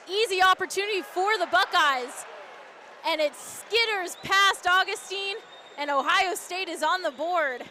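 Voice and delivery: rising, drawn-out goal call; excited goal call. Real, not acted. Goal call, Ohio State scores.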